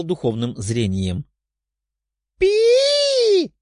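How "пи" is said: piercing shrill squeaky toy shriek; high squeak. Acted. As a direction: let out a sudden piercing squeal. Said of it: "раздался писк".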